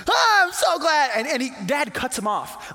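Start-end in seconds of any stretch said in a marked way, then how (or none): 0.1-1.1 high, loud burst of relief; overjoyed dad. Acted. Father cutting off his son's apology, overjoyed. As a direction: burst in, bubbling over with gladness. Dad cuts son off with joy.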